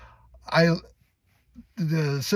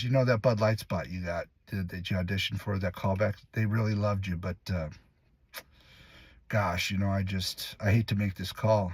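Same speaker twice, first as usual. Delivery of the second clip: low, hesitant mock letdown; stringing out bad news; 5 semitones lower, 4 dB quieter than usual. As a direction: stall regretfully, as if breaking bad news. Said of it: "she'll string that out as long as she can".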